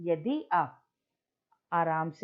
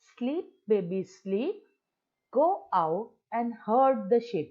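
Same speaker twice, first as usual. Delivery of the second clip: slow recital, louder at go; poem recitation; 2 semitones higher, 4 dB louder, much slower than usual. Shown as a demonstration. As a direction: recite slowly, pushing the command. Recites last stanza. (धीमा कविता पाठ)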